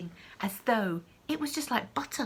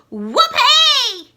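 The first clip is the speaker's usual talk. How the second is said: shrieked very high and loud; a wild old woman whooping; shouting with glee; 16 dB louder than usual. Acted. Grandma shouting with joy as she grows. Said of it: "she shouted".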